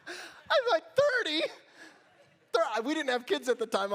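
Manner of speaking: loud, high, laughing through the words; laughing disbelief. Real, not acted. Pastor mocking the mom's count, loud laughing disbelief.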